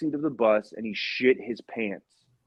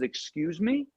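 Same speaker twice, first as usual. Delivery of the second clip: incredulous; 6 semitones higher, 5 dB quieter, slower than usual. Retold or imitated. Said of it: "And the bus driver says".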